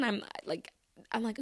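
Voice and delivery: ordinary talk, nothing marked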